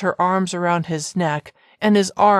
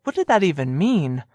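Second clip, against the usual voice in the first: plain reading voice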